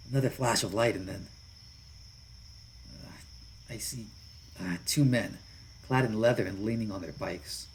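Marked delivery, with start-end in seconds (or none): none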